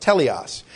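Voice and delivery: careful Greek Teleos, TEH-lee-os; Greek pronunciation. Shown as a demonstration. Preacher teaching the congregation the word Teleos, TEH-lee-os. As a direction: say Teleos slowly as TEH-lee-os, stress on the first syllable. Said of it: "teleos is the Greek word".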